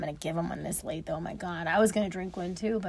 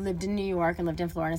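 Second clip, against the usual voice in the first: ordinary talk, nothing marked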